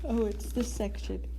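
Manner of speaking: talking through a yawn. Real, not acted. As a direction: say it mid-yawn, words stretched. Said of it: "Sorry, I'm yawning".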